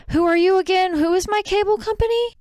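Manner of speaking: very high-pitched quizzing; imitated baiting question. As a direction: act out mom innocently testing the caller. Mom trolls caller with questions.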